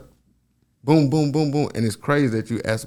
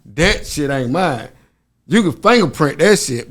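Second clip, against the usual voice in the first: ordinary delivery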